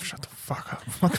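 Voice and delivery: harsh hissed whisper at the talkers; whispered shushing. Retold or imitated. Concertgoers behind them, whispering angrily to shut up.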